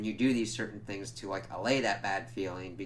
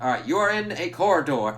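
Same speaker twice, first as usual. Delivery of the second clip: imitated hypnotist, higher and louder; Laszlo, commanding hypnotist; 6 semitones higher, 8 dB louder than usual. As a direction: command them like a stage hypnotist. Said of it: "doing the commanding hypnotist voice".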